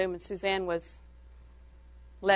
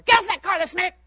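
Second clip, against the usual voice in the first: yelling mother; 9 semitones higher, 5 dB louder, faster than usual. Retold or imitated. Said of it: "She stuck her head out the door".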